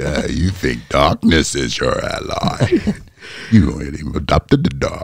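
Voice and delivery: slow, drawn-out villain voice; Bane. Acted. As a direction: play the film villain, stretching each word. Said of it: "I can do Bane".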